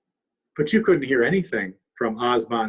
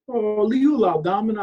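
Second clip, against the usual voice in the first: pleading raccoon; 9 semitones higher, 6 dB louder than usual. Acted.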